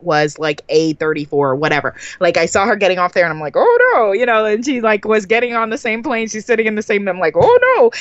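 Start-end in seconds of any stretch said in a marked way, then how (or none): 3.6-4.1 high, louder dismayed oh no; a quick rising groan of dread. Retold or imitated. Retelling the smelly seatmate, a high dismayed groan. 7.4-7.9 high, louder dismayed oh no; a quick rising groan of dread. Retold or imitated. Retelling the smelly seatmate, a high dismayed groan.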